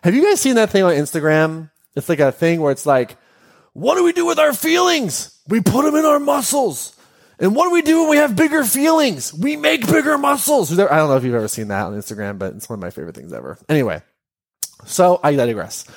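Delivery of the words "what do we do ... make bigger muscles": high, bright singsong quote that ends in a shout; playful mock quote. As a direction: ham up the silly meme line. Quoting an Instagram post.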